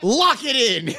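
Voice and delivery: loud, high-pitched whoop; triumphant. Real, not acted. Player locking in a pick, triumphant. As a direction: belt out the decision in delight.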